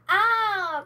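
high, loud yelp of alarm; trapped dragon crying out; dragon's cry. Acted. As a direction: let out a startled shriek. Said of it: "cried the magic dragon".

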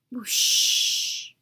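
breathy rushing whoosh; wind whoosh. A narrator's reading. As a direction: blow it out like the wind. Spelling the wind sound.

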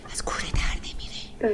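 whispered aside. Real, not acted. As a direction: whisper it close to the mic. (آهسته و نجواگونه)

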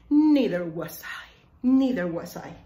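a touch of a refined butler; lightly voiced stately butler; elegant butler. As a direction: say it like a posh butler serving. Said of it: "como que más elegante".

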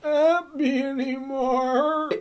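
put-on high, slow, crying old alien; ancient Martian voice. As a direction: wail it slowly like an ancient Martian. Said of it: "And he sounds like that".

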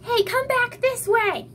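high, loud yell. A narrator's reading. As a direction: call the troll away from Tim. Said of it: "Raphael yelled".

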